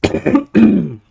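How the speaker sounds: loud real cough; hacking cough. Real, not acted. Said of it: "Sorry for the coughing".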